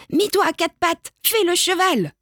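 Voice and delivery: high-pitched little commander; bossy child ordering. A narrator's reading. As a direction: order them about in a kid's voice. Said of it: "ordonne-t-il".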